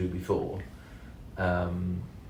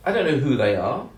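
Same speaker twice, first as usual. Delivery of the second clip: normal talking voice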